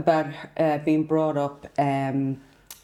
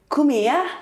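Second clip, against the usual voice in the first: higher, louder Coventry "Come here"; 8 semitones higher, 4 dB louder than usual. Retold or imitated. Imitating Coventry women in the nail bar, Coventry accent. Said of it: "They say".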